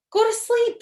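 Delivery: very high, loud outburst; loud, high shout. A narrator's reading. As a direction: Toad yells it at Frog. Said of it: "shouted Toad".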